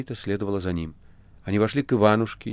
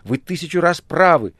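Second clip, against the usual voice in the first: high, loud exclamation; impassioned cry; 8 semitones higher, 7 dB louder than usual. A narrator's reading. The Master tells Azazello he is right, crying out. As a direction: cut in with fervent agreement. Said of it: "вскричал мастер".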